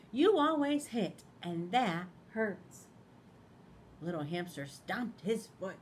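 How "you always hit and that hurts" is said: loud, slow pig voice, then easing off; loud scolding Piggy. Acted. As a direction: scold him, then let it hurt.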